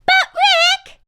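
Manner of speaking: very high, loud burst; excited exclaim. A narrator's reading. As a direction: call out in delight. Tess exclaiming.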